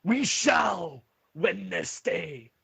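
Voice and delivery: high, drawn-out rallying speech to the troops; rally your soldiers from the battlements, pitched high; commander's war cry before battle; rousing battle shout. Acted. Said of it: "continues to shout".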